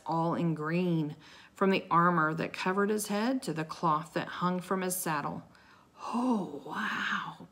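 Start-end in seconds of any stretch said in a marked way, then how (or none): 6.1-7.5 hushed, drawn-out wonder; breathed in awe. A narrator's reading. Awed girl sees the knight ride in, breathed. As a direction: breathe it out, trailing into a whisper. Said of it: "breathed Annie".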